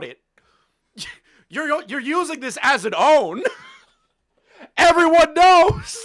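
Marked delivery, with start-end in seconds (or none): 1.5-6.1 laughing, higher and louder; can't stop laughing at it. Real, not acted. Laughing at a reviewer mocking his firing, laughing.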